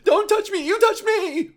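very high, loud hysterical shrieking; mock meltdown shriek. Man being filmed shrieks at the camera person in a childish meltdown. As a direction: throw a tantrum like a child. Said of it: "the person being videotaped is like".

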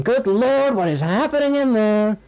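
loud and high, startled cry; alarmed Chalmers. Retold or imitated. Chalmers seeing the kitchen ablaze, loud alarmed cry. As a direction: shout up in alarm.

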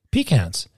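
pecans said PEE-cans; imitated pronunciation. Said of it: "I've heard you say".

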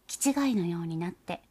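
plain reading voice

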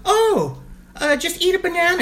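very high, bright-idea mock voice; high-pitched helpful impression; imitated sister. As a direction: pipe up high with silly advice. Voices sister offering a silly remedy.

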